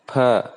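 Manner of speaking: "fa" as an aspirated p, both lips touching; Hindi fa sound. Shown as a demonstration. Teacher isolating the Hindi sound for learners, bilabial "fa". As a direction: make "fa" with the two lips, like Hindi ph. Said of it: "When we say fa sound in Hindi".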